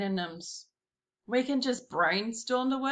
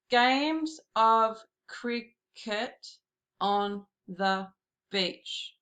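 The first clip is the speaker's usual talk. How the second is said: slow syllable count; much slower than usual. Shown as a demonstration. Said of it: "I've got seven syllables in the second line".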